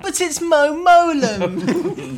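very high, loud, drawn-out laughing plea; mock protest. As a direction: wail it slowly in comic exasperation. Imagined excuse to the girlfriend, laughing.

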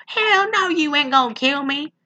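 high, sassy mock comeback; sassy retort. Podcaster hamming up Bella's reply to a vampire. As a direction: jump way up, all attitude. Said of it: "she more or less said".

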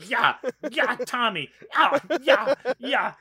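high, loud goofy repeated cheer; mock awkward cheering. Mocking Eric Roberts' awkward ringside cheering, goofy high cheer. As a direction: cheer like a clueless dancer. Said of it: "and he's just like".

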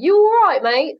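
chirpy East London mate; hammed-up cockney; East London accent. Shown as a demonstration. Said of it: "like, East London".

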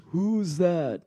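put-on a frail old man asking. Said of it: "So this is the old man voice".

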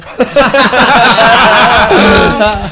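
big high burst of laughter; loud laugh. Real, not acted. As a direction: roar with laughter. Big laugh after joke.

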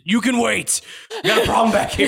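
high-pitched holler to the front; harried employee calling back. Acted. Employee busy with an intruder answers customer, high harried call.

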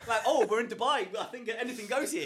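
high and loud, laughing through the line; laughing and giddy. Retold or imitated. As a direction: giggle it out, carried away. Laughing at shotgun start.

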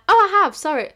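high, loud mock-apologetic voice; over-apologetic reply. Waitress correcting a customer about the bill, high loud apology. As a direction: act out a chirpy-high, loud sorry.

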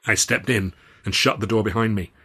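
ordinary delivery, nothing marked

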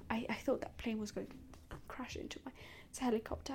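hushed, breathy relief after a scare; calming herself after thinking a plane would crash; relieved whisper. Real, not acted. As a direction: whisper it as the fear drains.

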